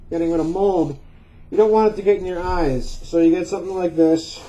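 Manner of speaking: muffled behind mask; speaking through a respirator. Real, not acted. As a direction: mouth covered by respirator. Seen 2: Half-face respirator is worn over mouth and nose throughout.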